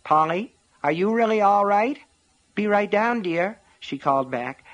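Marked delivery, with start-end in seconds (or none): 0.0-2.0 calling upstairs, raised and anxious; worried, raised voice with rising pitch. A narrator's reading. 2.5-3.6 falsely bright and lively; calling back, bright and high; forced cheer. A narrator's reading. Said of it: "trying to sound bright and lively".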